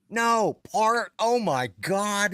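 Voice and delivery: high, loud, scandalized brother; imitated shocked correction. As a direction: protest in disbelief, stretching the last words. Brother shocked by the misheard word.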